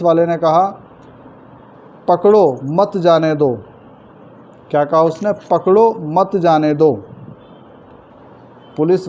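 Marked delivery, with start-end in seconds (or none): 2.1-3.6 firm पकड़ो, then break before मत जाने दो; pause after पकड़ो. Acted. Policeman calls to a passer-by about a thief, pause after पकड़ो. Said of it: "मत को पीछे रखा". 5.3-6.9 पकड़ो as a separate order, break, मत जाने दो; pause after पकड़ो. Acted. Teacher repeats policeman's order, pause after पकड़ो. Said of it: "मत को पीछे रखा".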